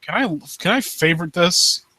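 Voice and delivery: ordinary talk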